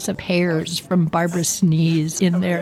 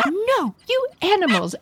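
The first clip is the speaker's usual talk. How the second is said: mom scolding the dog; 11 semitones higher than usual. Retold or imitated.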